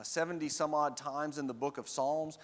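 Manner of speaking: normal talking voice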